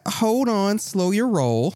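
high, stalling character voice; shopkeeper protesting. Acted. Game master as shopkeeper slowing the customer, in high character voice.

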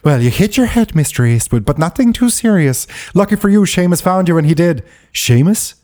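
a hint of a movie Irish brogue; mock Irish accent. Host quoting Maggie to Marty in a light Irish accent.

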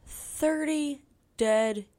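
slow, portentous announcer delivery; mock TV report. Imagining the couple on TV, mock news report. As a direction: announce it in spaced-out phrases.

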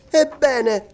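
high-pitched, challenging outburst; defiant exclamation. A narrator's reading. Young brother answering that hope is gone, exclaiming defiantly. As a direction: burst out boyishly, ready to fight. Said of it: "esclamò il giovane Robert".

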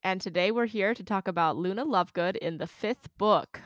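usual speaking voice

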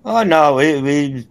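ordinary talk, nothing marked

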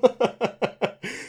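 high laugh fading out; high squealing laugh. Real, not acted.

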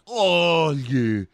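loud, high, drawn-out posing moan; hammed-up sultry cry, falling away; mock seductive moan. [name] posing for Dean's attention, mock seductive moan.

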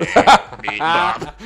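loud high beep-bop robot noises. Acted. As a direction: make robot beeping sounds. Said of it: "robot sound effects".

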